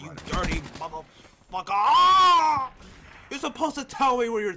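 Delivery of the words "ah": loud, high frustrated yell; real scream of gaming rage; angry scream. Real, not acted. Raging scream at death.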